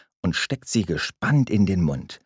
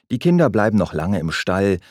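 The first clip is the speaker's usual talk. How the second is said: plain reading voice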